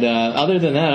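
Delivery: ordinary talk, nothing marked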